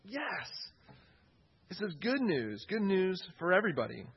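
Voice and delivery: high, eager announcing; excited exclamation. Retold or imitated. Disciples sharing the news, excited exclamation. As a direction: cheer the good news to everyone. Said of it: "There's this exclamation with it".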